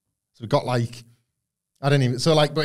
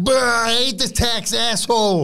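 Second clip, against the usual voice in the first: much higher, slower, louder mock fan jeer; booing American fan; 10 semitones higher, 5 dB louder, much slower than usual. American crowd booing the tax villain, hammed-up jeer. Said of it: "it was like".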